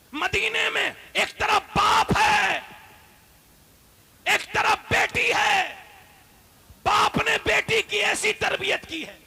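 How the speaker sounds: loud, high, slow shouted preaching; fiery preaching. Acted. Preacher proclaiming the Prophet and his daughter, slow fiery shout. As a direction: hammer each phrase out to the crowd.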